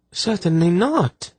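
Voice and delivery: very high-pitched indignant denial; shocked. A narrator's reading. Lady answering the soldier's plea, shocked. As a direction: recoil in shock, voice leaping up. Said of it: "she replied in a shocked voice".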